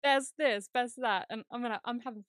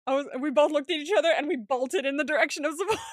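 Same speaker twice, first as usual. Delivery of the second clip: loud, higher, laughing storytelling; laughing while talking; 4 semitones higher, 8 dB louder, faster than usual. Real, not acted. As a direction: tell it through bursts of laughter. Laughing while retelling the smash.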